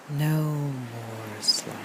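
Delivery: drawn-out, fading meditative chant; sounds like coleslaw. Pretend. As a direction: stretch "No Moore's Law" until the words run together. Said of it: "It sounds like I'm saying".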